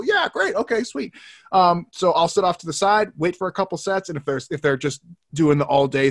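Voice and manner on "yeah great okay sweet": eager reply. Pretend. Acting eager gym-goer.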